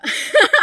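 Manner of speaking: high, loud burst of laughter. Real, not acted. Reviewer after defending the actress, high loud laugh.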